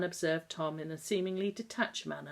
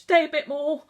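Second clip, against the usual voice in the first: begging; 11 semitones higher, 12 dB louder than usual. Acted. Boy begging guardian to stay.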